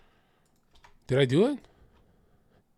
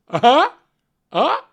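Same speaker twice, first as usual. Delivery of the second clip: high, loud, rising "uh-huh"; goofy prodding "huh?" fishing for a laugh; imitated eager upward grunt; mock prompting "uh-huh"; 10 semitones higher, 7 dB louder than usual. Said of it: "go like this".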